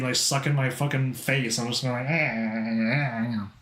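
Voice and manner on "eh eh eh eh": slow, low "eh" noises; mock demo of finding the voice; Valley Girl warm-up noise. Shown as a demonstration. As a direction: draw out small strained "eh" sounds. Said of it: "My Valley Girl voice is just trying to be".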